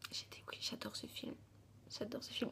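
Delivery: whispered adoration of the film; whispered confession. Real, not acted. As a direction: confide it in a hush. Confiding love for the film.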